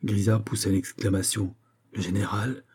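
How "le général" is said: plain reading voice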